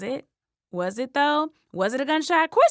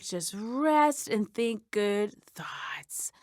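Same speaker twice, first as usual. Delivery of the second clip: soft whispered therapist; 4 dB quieter, much slower than usual. Retold or imitated. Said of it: "Therapist is like".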